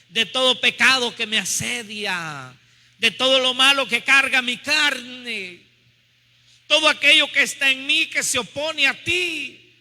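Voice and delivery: slow prayer rising in pitch as the list builds; fervent prayer. Real, not acted. Preacher asking to be cleansed of sin, fervent prayer. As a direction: plead with God, drawing out each phrase.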